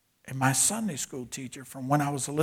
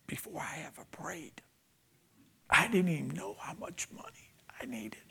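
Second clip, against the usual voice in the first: breathy, reverent hush; hushed whisper; 6 semitones higher, 4 dB quieter than usual. Retold or imitated. Preacher voicing his brother's prayer to the congregation, whispered. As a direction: whisper it softly, like a confided prayer.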